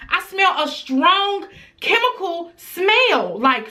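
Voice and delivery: usual speaking voice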